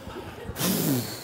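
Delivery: long, soft weary sigh; exhausted sigh. Acted. Family worn out by dad's talking, exhausted sigh. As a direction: let out a worn-out breath, acted. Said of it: "Everybody's just exhausted".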